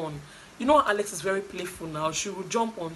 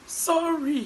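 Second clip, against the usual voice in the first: high, loud mock-sobbed apology; gasp, then wail it like a crybaby; imitated crying; 9 semitones higher, 5 dB louder than usual. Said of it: "And she was crying".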